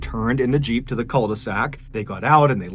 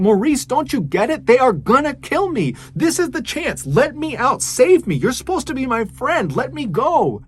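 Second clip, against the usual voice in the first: much higher and louder, desperate begging; frantic pleading; 12 semitones higher, 4 dB louder than usual. Retold or imitated. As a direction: plead with a friend who won't help, voice pitched way up in panic. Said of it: "Rachel couldn't believe what she was hearing".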